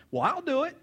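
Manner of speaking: high-pitched acted offer; eager volunteer. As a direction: pipe up as a young volunteer. Youth volunteers to fight the giant.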